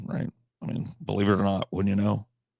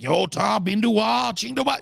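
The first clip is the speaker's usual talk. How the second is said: high, loud gibberish Korean rant, rising higher; mock Korean scolding, pitched up; angry mock Korean; 12 semitones higher, 4 dB louder than usual. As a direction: fake angry Korean yelling at the men. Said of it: "you can tell when somebody's mad".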